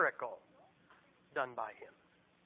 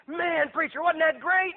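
high, excited praise; mock-enthusiastic congregant, pitched up; gushing church member; 8 semitones higher, 5 dB louder, slower than usual. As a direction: imitate an impressed churchgoer gushing.

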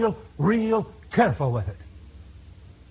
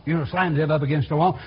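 ordinary delivery

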